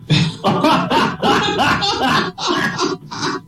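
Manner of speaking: breathy high laugh. Real, not acted. Comedian to audience, laughing. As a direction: wheeze with laughter. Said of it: "teller laughs at punchline".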